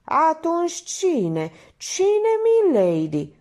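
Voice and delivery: high, loud, drawn-out demand; sudden yell. A narrator's reading. Painter demanding a name from milady, yelling. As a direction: yell the question, stretching it. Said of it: "urlă din senin".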